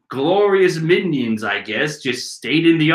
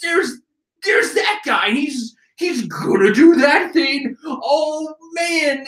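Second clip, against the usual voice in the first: high, stammering mock panic; stating the obvious; 9 semitones higher, slower than usual. Minion on another panel, high mock-panicked shouting. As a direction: wail it like a hammed-up dim minion. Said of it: "they just go stating the obvious".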